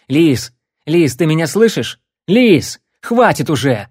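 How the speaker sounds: desperate shouting; frantic shout. Acted. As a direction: yell to wake him. Shouting at unconscious friend.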